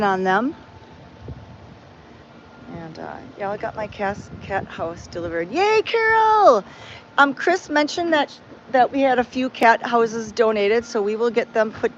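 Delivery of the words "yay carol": much higher, slow, delighted "Yay"; cheer. Real, not acted. Thanking Carol for the donated cat house, cheering high.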